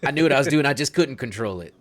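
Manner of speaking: forceful in-character confession; corrected character voice. Acted. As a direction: push the confession out loudly, southern accent dropped. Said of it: "sorry, I'm still doing the southern accent".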